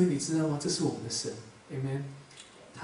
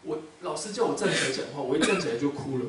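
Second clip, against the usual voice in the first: normal talking voice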